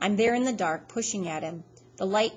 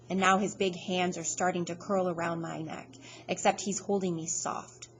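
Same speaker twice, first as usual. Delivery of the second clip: ordinary delivery, nothing marked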